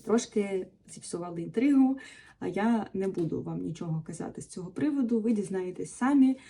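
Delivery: ordinary talk, nothing marked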